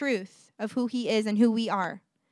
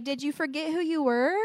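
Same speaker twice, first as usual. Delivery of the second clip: put-on higher, lilting question to a child; gentle parent; 5 semitones higher than usual. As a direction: ask it like a mom coaxing her kid.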